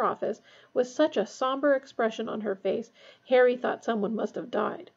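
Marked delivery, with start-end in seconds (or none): none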